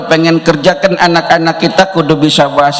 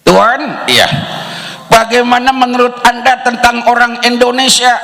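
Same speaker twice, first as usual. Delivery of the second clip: playful translation; 7 semitones higher than usual. Retold or imitated. Indonesian version of the question.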